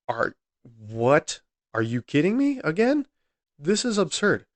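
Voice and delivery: high, incredulous outburst; stunned disbelief. Real, not acted. Podcaster to listeners about the verdict, high incredulous outburst. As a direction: react like you can't believe the verdict.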